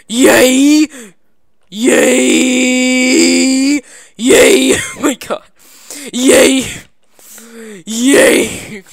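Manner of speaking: loud, high repeated yay; excited cheering. Real, not acted. Gamer cheering each jump, loud high yays. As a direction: cheer every landing.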